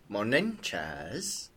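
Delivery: low, cool greeting in a lightly voiced rogue; a hint of a terse rogue; Dusk's voice. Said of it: "between two character voices".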